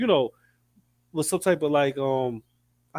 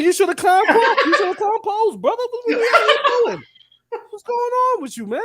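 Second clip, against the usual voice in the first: high and louder, laughing through it; incredulous high laughing yell; laughing exasperation; 4 dB louder than usual. Retold or imitated. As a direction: shout at him while cracking up. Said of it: "I was like".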